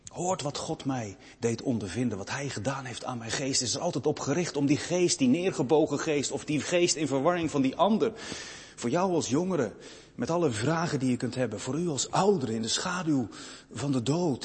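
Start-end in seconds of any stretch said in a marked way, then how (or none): none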